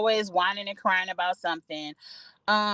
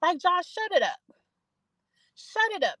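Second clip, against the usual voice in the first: pitched way up, scolding Josh; exasperated high scolding; 6 semitones higher than usual. Retold or imitated. Telling Josh off while watching, high exasperated scold. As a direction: snap at him to shut it up, high and sharp. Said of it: "I'm like".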